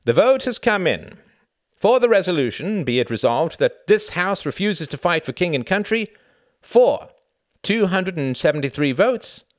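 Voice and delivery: high, loud proclamation to a crowd; loud announcement. A narrator's reading. Doctor Simons giving the vote to a noisy hall, loud announcement. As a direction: call out the result, slowing on the final figure.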